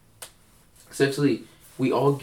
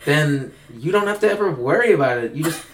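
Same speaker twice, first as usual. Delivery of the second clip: put-on higher, breezy party-girl voice; carefree festival girl impression, pitched up; imitating a Coachella fan; 4 semitones higher than usual. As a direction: sell Coachella as the cure for everything. Imitating the Coachella expert's answer.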